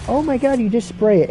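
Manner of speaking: high, gushing infomercial voice; mock TV ad pitch. Mom in the cartoon hyping her spray, gushing ad voice. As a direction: sell the spray like a cheesy commercial. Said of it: "And it's like".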